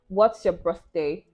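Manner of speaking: ordinary talk, nothing marked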